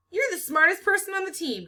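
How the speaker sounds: high, loud exclaim of encouragement. A narrator's reading. Aide insisting Melody is the smartest, exclaiming. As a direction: cheer her up with conviction. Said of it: "Catherine exclaims".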